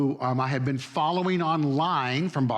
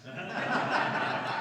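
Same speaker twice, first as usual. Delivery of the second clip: an airy, voiceless laugh; soft real laugh through breath; breathy laugh; 10 semitones higher, 5 dB quieter than usual. Real, not acted. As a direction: laugh almost silently. Laugh at the punchline.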